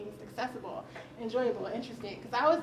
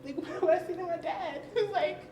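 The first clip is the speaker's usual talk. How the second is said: usual speaking voice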